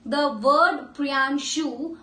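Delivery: "प्रियांशु" said slowly, the final ऊ held long; slow model word. Shown as a demonstration. Teacher modelling "प्रियांशु" for students to copy. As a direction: draw out "प्रियांशु" ending on a long ऊ. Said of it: "बोल के देखिए".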